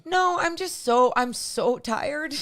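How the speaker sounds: high, strained and close to crying; overtired and about to cry; near tears. Real, not acted. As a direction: draw out the tiredness, tearful. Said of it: "You're about to cry now?".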